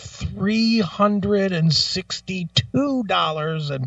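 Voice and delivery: very slow, drawn-out reading of the balance; mock slow tallying. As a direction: spell out the sum painfully slowly. Mimics customer updating register.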